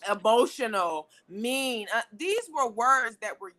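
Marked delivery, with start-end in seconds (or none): none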